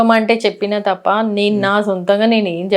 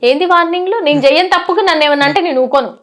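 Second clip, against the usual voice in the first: higher-pitched, indignant retort; defiant; 7 semitones higher than usual. Real, not acted. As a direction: push back with real indignation. Defiant reply about warning trolls. (ధిక్కారంగా, కాస్త కోపంగా)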